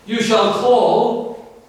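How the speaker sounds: very slow, each word weighed; slow solemn reading. Acted. Preacher reading God's promise to the congregation, slowly. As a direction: draw out You shall call.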